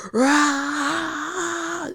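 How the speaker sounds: long, high-pitched roar; mock rage roar. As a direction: imitate a creature charging in rage. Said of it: "like, this rage".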